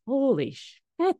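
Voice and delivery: slow, drawn-out, high and loud holy shit; shocked exclamation. Real, not acted. Host startled by her own words, high, loud and slow. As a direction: let the shock stretch the words.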